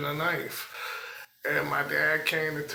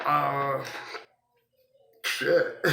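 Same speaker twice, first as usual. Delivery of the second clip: ordinary talk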